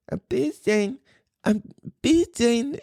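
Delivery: put-on high-pitched little-kid voice with a cute accent; tiny, high imitation of a small child; toddler saying German goodbye. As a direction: squeak it out like a three-year-old trying German. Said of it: "and he says ... he's got the little accent".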